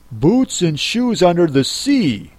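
usual speaking voice

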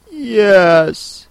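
high, hesitant yes; doubtful. A narrator's reading. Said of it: "said Alice doubtfully".

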